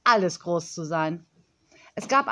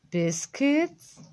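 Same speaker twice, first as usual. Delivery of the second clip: puzzled echo, pitched up; higher, rising, baffled; astonished question; 5 semitones higher than usual. A narrator's reading. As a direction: repeat the odd word in surprise. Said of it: "fragte Frau Honig erstaunt".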